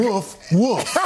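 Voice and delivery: playful mock dog woof with a laugh; dog barks. Weatherman barking like the dogs for the anchors, mock woof. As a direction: bark like the dogs with that other accent. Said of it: "that other accent".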